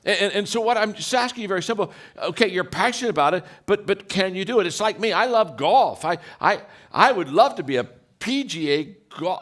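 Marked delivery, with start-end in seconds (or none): none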